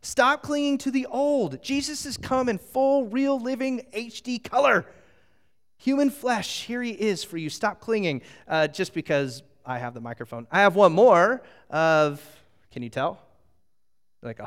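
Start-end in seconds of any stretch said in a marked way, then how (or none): 0.0-8.2 preaching plea. Retold or imitated. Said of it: "the writer is trying to say".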